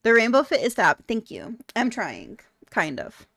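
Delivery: normal talking voice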